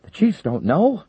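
high, slow, alarmed question; horrified realization; alarmed disbelief. Acted.

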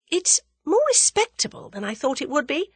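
put-on high, musing young woman; thoughtful. A narrator's reading. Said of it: "said Tuppence thoughtfully".

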